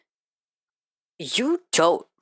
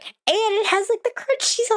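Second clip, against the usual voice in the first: excited squeak. Real, not acted. Gushing about Peep Oreos.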